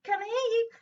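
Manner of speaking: high, loud call down a crackly phone; caller on a bad line. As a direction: shout into a bad telephone line. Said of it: "if someone had a bag of peas".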